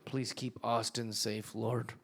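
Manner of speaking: mock prayer. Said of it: "I'm always like".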